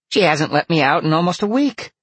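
high, loud vixen voice; complaining vixen. A narrator's reading. Vixen complains of being shut in.

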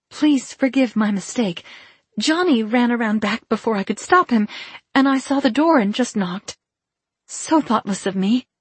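high, apologetic woman's voice; apologetic mother. Acted. Injured mother excusing her knock at the back door, apologetic.

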